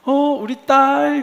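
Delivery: high, loud, drawn-out delighted greeting; bright cheerful phone greeting. Retold or imitated. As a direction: imitate a parent gushing happily to a daughter on the phone. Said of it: "너무 그 명랑한 표정과 말투로".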